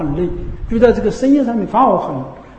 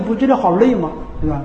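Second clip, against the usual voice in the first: ordinary delivery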